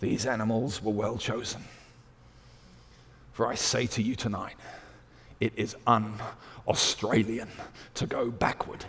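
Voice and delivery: very soft, grave pronouncement; imitating a speaker's dramatic hush; hushed after-dinner orator. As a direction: lean in and confide it solemnly, almost whispering by the end. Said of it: "it went quiet. And he leant forward and he said".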